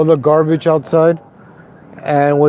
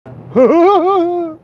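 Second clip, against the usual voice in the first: high breathy laugh; 11 semitones higher than usual. Real, not acted. Laughs at own impression.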